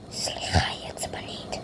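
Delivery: hushed secret aside behind her back; a quick whispered apology. Acted. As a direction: whisper the excuse so she won't hear. Aside about angry customer.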